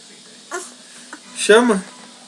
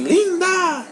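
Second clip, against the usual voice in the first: put-on "Linda" said much higher, sing-song, for the child to copy; high baby-talk prompt; high prompt for a toddler; 11 semitones higher than usual. Shown as a demonstration. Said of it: "Fala assim".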